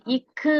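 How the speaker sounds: இக்கு with a firm stop on the k; pressed hard consonant. Shown as a demonstration. Teacher showing pupils vallinam, pressed hard sound. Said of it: "அழுத்தி சொல்றீங்க இல்லையா?".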